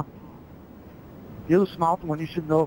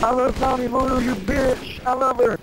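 mock yell; 8 semitones higher than usual. Yelling his love in game.

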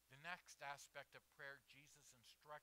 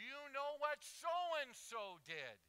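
high, loud, drawn-out question; indignant complaint; 12 semitones higher, 14 dB louder, much slower than usual. Acted. Believer tells God about someone else's wrong, loud complaint. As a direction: stretch the question out, loud and pitched up.

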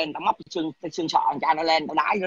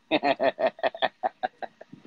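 staccato chuckle; a run of short, even chuckles; 3 semitones lower than usual. Real, not acted. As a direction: laugh in quick separate pulses. Chuckles after his own threat.

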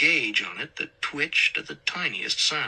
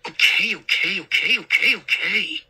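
higher, louder burst of hissed impatience; exasperated hiss; 7 semitones higher, 7 dB louder than usual. A narrator's reading. Son giving in to mom, exasperated hiss. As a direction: cut her off, hissing and exasperated. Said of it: "he hissed".

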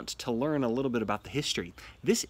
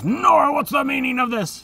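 loud, high mock demand; hammed-up shocked husband calling out; Torvald demanding; 11 semitones higher, 8 dB louder than usual. Teacher voicing Torvald catching Nora at the door, hammed-up loud demand. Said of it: "Torvald comes in and says".

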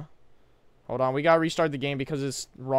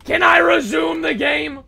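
slow, high, loud demand; frustrated shout; 11 semitones higher, 10 dB louder, slower than usual. Real, not acted. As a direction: drag it out in frustration. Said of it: "third, loudest take".

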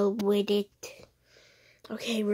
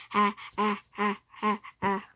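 ordinary delivery

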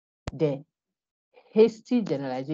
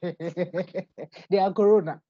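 real laughter around slow words; laughing; 2 semitones higher, much slower than usual. Real, not acted. Teacher amused by the coronavirus example, laughing. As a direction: say it while cracking up.

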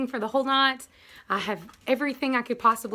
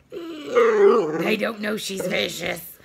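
slow, drawn-out mock-sinister warning; mock menace; 7 dB louder, much slower than usual. To the viewers about her gentle dog, slow mock menace. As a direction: play up how dangerous the dog is, slowly.

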